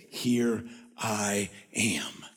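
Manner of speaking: drawn-out, hushed divine reply; slow solemn answer. Acted. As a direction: stretch each word out slowly. Voices the Lord's answer.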